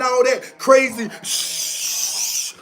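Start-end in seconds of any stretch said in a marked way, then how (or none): 1.2-2.5 drawn-out "shh" cutting off the word; long hissed shush. Real, not acted. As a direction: bite off the curse into a long hiss. Cuts off a swear with hiss.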